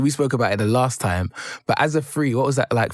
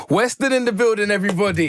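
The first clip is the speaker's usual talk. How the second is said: hype intro; 9 semitones higher than usual. Real, not acted. Host hyping guests' arrival.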